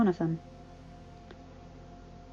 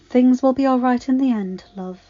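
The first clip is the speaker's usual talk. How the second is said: ordinary delivery, nothing marked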